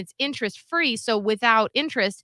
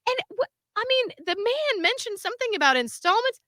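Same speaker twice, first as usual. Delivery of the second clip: high-pitched imitated caller; smooth phone manner; 13 semitones higher than usual. Person asking company about installments, phone manner. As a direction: hold it up high, politely pressing the point. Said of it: "he's so good on the phone".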